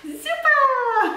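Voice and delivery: high, loud, laughing praise; excited praise. Real, not acted. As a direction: cheer the little one on. Praising the toddler's fist bump.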